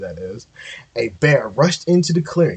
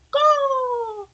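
shrill high bird squawk; high bird caw. A narrator's reading. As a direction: squawk like a proud eagle. Eagle cries out in story.

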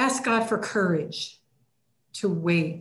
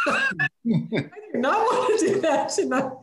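high, laughing refusal; wife laughing as she refuses her husband; 7 semitones higher than usual. Retold or imitated. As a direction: giggle through the words.

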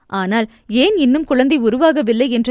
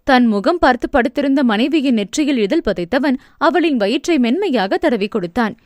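plain reading voice

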